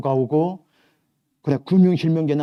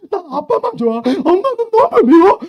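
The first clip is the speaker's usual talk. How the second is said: put-on whiny crying child; 11 dB louder than usual. Little daughter crying to her dad about mom, high whiny wail. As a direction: whine it like a sobbing little girl.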